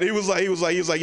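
ordinary talk

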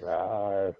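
playful little rawr; dinosaur roar. Pretend. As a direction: growl like a toy dinosaur. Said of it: "I'm an angry hand puppet".

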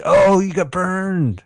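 high, drawn-out jeer; mock taunt. Shown as a demonstration. Demonstrating to co-host how insults get called, mock taunting. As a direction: stretch it into a crowing tease.

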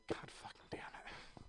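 under-breath whispered curse. Retold or imitated. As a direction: hiss it to yourself, exasperated. Frustrated reaction to being asked.

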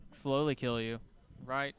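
ordinary talk, nothing marked

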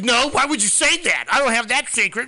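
high, loud outraged girlfriend imitation; indignant mock denial. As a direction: protest shrilly in mock outrage.

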